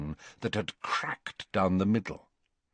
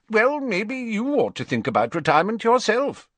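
lightly voiced blunt old wizard; high, blunt old man voice; blunt Slughorn; 14 semitones higher, 5 dB louder than usual. A narrator's reading. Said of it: "said Slughorn bluntly".

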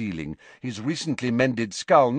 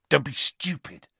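sharp; 4 semitones higher than usual. Acted. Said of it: "said Ron sharply".

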